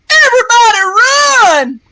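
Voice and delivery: high, loud call to flee; frightened soldier; panicked shout. Acted. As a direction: yell everyone away. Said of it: "somebody cry out".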